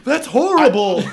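high, loud laughing cry. Real, not acted. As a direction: squeal it through laughter. Laughing reaction.

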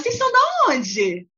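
high, chatty imitation of a stranger in line; nosy Brazilian tourist. Stranger in the ride queue, chatty high voice. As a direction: chirp it like a friendly busybody. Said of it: "a pessoa que tá atrás".

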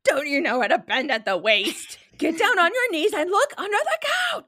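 loud, rising exasperated parent yell; mock parental shouting. Parent telling a child to find the iPad, mock shouting. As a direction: play a parent yelling orders, climbing high. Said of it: "Do you like to shout?".

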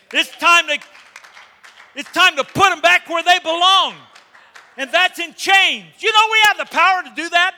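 loud, high fervent preaching. Real, not acted. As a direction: preach it full force, rising. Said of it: "preaching at full force".

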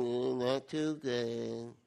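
low, soft, slow gloomy moan; mopey sulker droning low; mopey person. Acted. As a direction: sink into a deep glum mumble. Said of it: "You ever see a mope person?".

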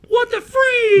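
very high, loud squeal; crowd exclamation. Retold or imitated. Imagining the crowd reacting, a loud high squeal. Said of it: "the crowd would be like".

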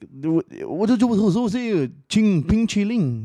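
imitating the meme. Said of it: "he was like".

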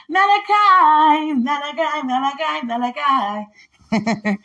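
celebrating that she got the whole list right; triumphant finish. Real, not acted. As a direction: sing out the last book in victory.

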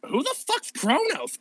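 puzzled aside; baffled Persephone. Acted.

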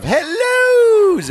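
high, drawn-out singsong hello; Missus Doubtfire. Retold or imitated. Teasing about dogs hating the movie, high mock greeting. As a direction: stretch it out in a high falsetto. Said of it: "the movie Missus Doubtfire".

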